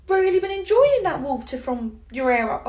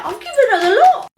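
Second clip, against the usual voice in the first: gleeful laughing; 4 semitones higher, 9 dB louder, faster than usual. Acted. Gleeful about prank.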